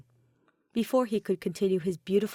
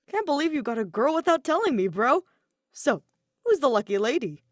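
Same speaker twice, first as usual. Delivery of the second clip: teasing; 6 semitones higher, 5 dB louder than usual. Acted. Kaito teasing his friend loudly.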